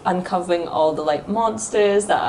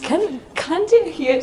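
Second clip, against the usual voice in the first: a bit higher, slower, half-giggling; high pitch mode; 9 semitones higher, slower than usual. Pretend. Host slipping back into high pitch mode. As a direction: slip into a higher voice. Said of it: "I've gone back into high pitch mode".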